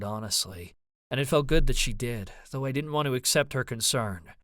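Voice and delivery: usual speaking voice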